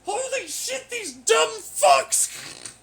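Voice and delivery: hammed-up shrieks of terror; mock panicked screaming. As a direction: imitate someone screaming for their life, high and very loud.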